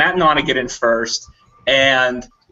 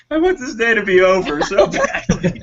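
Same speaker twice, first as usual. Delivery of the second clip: higher, weary whine; a higher mock-weary whine; mock exasperation; 6 semitones higher than usual. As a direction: sound at the end of your rope. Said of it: "I'm like".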